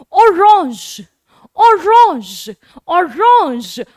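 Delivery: ऑरेंज with a French r and nasal a; slow French ऑरेंज; teacher modelling French orange. Shown as a demonstration. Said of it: "तो ऑरेंज बन गया आपका ऑरेंज".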